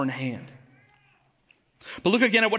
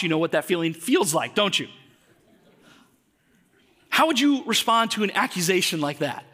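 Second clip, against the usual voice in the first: ordinary delivery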